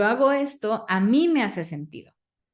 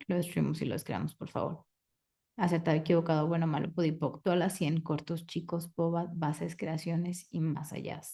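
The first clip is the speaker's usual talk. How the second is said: the formula murmured, softer, fading almost to a whisper; soft rote clearing statement; 2 semitones lower, 7 dB quieter than usual. Acted. Facilitator closes the question with a hushed rote clearing. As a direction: let the ritual words sink away quietly.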